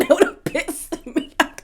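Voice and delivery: laughing through the words. Real, not acted. Vlogger laughing at how annoying it would be. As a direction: giggle it out.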